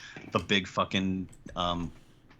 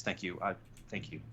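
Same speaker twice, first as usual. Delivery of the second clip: quiet, softer thank you; 2 semitones lower, 10 dB quieter than usual. Acted. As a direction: murmur the thanks gently. Grateful NPC quietly thanking.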